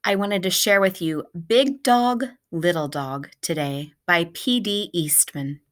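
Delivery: usual speaking voice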